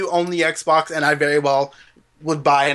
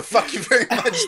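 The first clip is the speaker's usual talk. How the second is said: laughing through the words; giggling retort; 5 semitones higher than usual. Real, not acted. Repeats the retort while laughing.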